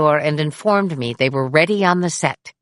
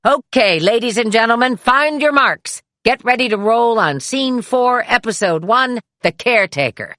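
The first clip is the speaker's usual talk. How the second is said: higher, louder assistant director's call; called out on set; 7 semitones higher, 4 dB louder than usual. Retold or imitated. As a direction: call it out across the whole stage, louder and higher. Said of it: "the first A D called out".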